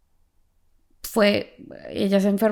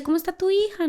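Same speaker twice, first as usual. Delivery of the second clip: voicing the pastor; 11 semitones higher, faster than usual. Pastor voiced in story.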